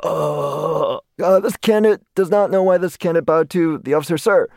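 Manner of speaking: high, strained recruit's formal reply; imitated flustered candidate. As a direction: play a cornered cadet reporting stiffly. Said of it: "I'm like".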